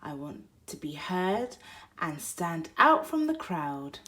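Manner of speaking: plain reading voice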